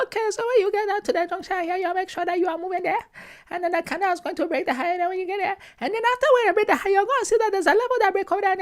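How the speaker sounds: imitating chemistry teacher. Imitating his Ghana chemistry teacher, high mock accent. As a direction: ape the mumbling chemistry teacher. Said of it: "she'd be like".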